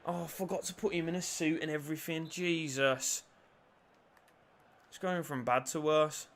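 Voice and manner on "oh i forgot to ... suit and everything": usual speaking voice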